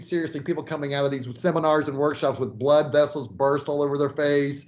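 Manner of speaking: ordinary talk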